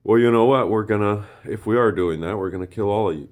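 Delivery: furious threat. Retold or imitated. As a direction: bark the threat back at them. Acted furious threat in quote.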